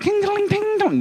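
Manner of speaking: high ringing "ting" noises; ping it out high, like little bells; imitated tinging. Mimics the poi ball noises.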